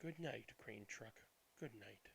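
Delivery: ordinary delivery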